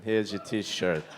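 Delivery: slow, low Queens accent; thick New York street vendor. Shown as a demonstration. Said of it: "That's my New York accent".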